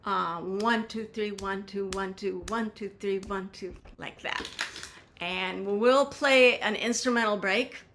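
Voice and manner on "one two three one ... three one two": counting out a rhythm. Shown as a demonstration. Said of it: "It goes like".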